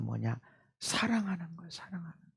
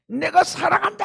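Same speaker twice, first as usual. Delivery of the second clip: retold yelling; 8 dB louder than usual. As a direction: re-enact an involuntary outburst at the gate. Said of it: "나도 모르게 소리를 질렀어요".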